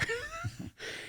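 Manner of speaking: quick breathy laugh at himself; short laugh. Real, not acted. Laughing while telling the vet interviewer about dodging the border. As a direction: laugh off the memory.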